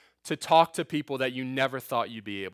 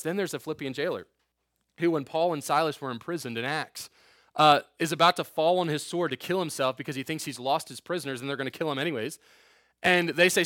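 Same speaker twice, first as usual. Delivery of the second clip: usual speaking voice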